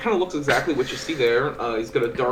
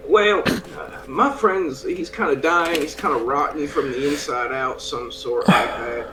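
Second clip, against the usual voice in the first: a lightly voiced rough traveler, a bit higher and louder; easygoing ranger chatting up the shopkeeper; in-character adventurer; 4 semitones higher, 8 dB louder than usual. Player speaks in character.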